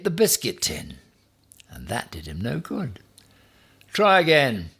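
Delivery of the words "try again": loud high command; stern order. A narrator's reading. Ham orders porcupine to try again: loud command. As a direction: bark it out, loud.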